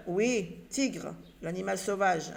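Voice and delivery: ordinary talk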